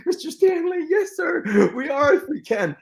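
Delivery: very high giddy squeal breaking into laughter; starstruck fanboy. Retold or imitated. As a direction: gush in a squeaky, giggly falsetto. Acts out starstruck reply to Lee.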